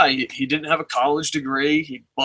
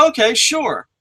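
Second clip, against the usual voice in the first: skeptical okay; 8 semitones higher than usual. Retold or imitated. Reluctant agreement.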